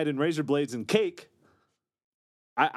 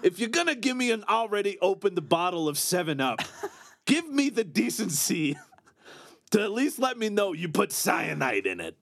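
high, excited rant with laughs; Jason Mantzoukas voice; 7 semitones higher than usual. Pretend. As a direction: rant like an indignant comic. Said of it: "I just sounded like Jason Mantzoukas".

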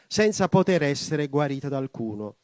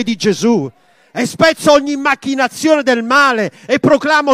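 loud, high impassioned prayer; a preacher crying out to God; fervent preaching prayer; 10 semitones higher, 13 dB louder than usual. Real, not acted. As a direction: pray out with mounting fervour. Prayer at full force.

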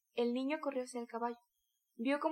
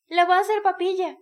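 much higher, much louder yell; crowd shout; 7 semitones higher, 14 dB louder than usual. A narrator's reading. Onlookers shouting at the beating, loud high yell. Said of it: "gritaron los testigos".

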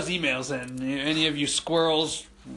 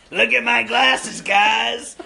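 higher, louder proud brag; imitating Squirrel showing off; 4 semitones higher, 6 dB louder, slower than usual. Teen Squirrel showing off glasses to friends, loud mock brag.

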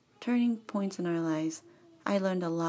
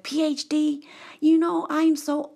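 higher-pitched mock Nigerian accent; imitated Nigerian accent; 8 semitones higher, 5 dB louder, slower than usual. Nigerian PhD complains about work, imitated accent. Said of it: "He's a Nigerian guy".

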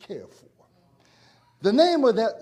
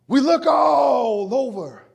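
slow, loud, stretched-out cry; preacher's shout; 4 semitones higher, 7 dB louder, slower than usual. Acted. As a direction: stretch it out and call it to the room.

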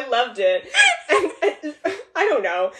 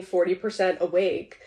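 ordinary delivery

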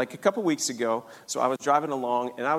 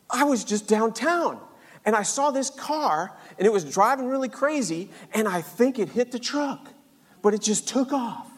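lightly voiced dad, higher pitch; animated, raised-pitch retelling as dad; retold dad's account; 6 semitones higher than usual. Dad explains to son what he saw downtown, animated retold account.

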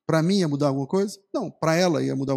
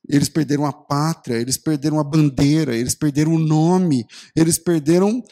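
a solemn, deliberate preacher's litany; 3 semitones lower, 3 dB louder than usual. Acted. Slow dramatic list of losses.

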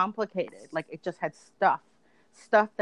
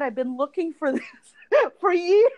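excited laughing; 10 semitones higher, 5 dB louder than usual. Retold or imitated. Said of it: "and I was like".

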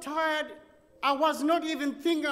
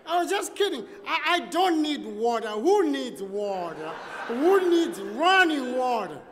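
higher, louder, laughing it off; to the clerk he was rude to, suddenly placating and laughing; changed tone; 5 semitones higher, 6 dB louder than usual. Retold or imitated. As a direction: backpedal with a nervous laugh, all jokes now. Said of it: "I changed my tone".

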